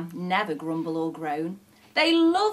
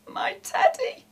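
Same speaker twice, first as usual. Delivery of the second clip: high-pitched, tearful little whimper; sniffling; 4 dB louder than usual. A narrator's reading. Scotty dog sobs over his stolen teddy, sniffling. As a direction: sniffle it like a sobbing pup. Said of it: "he sniffled".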